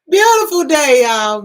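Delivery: high, loud cheerful call; a loud, high burst of cheer; loud greeting. Real, not acted. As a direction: shout it out to the viewers.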